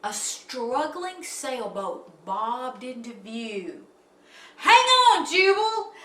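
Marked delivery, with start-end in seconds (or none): 4.6-5.9 high, loud urgent shout; anxious call. A narrator's reading. Rescuer calling to stranded Jubal, anxious shout. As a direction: call out to hold on. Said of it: "an anxious voice called".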